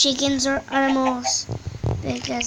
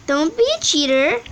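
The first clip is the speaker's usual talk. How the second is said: ordinary talk, nothing marked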